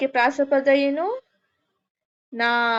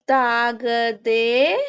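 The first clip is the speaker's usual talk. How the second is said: dictation; much slower than usual. Acted. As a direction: stretch each word so the class can write it. Dictating answer for writing.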